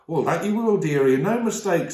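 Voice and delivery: a motherly beaver wife, cheerfully blunt; Missus Beaver. Acted. Missus Beaver warns the children about the lion, homely and blunt.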